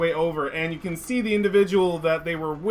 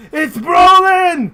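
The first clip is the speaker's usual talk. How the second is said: loud, slow, high joyful shout; overjoyed dwarf yelling a name; excited shout; 11 semitones higher, 11 dB louder, slower than usual. Acted. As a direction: bellow the name in delight. GM voices excited dwarf greeting.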